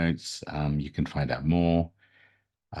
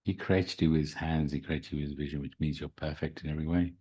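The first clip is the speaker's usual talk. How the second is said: guided meditation. Acted. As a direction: guide them into calm, gently.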